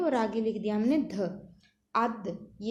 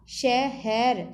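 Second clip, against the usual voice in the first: शहर stretched out very slowly, louder; drawn out slowly; 4 dB louder, much slower than usual. Shown as a demonstration. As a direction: pull शहर out slowly and clearly. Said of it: "जोड़ जोड़ के पढ़ना है". (धीरे खींच के शहर)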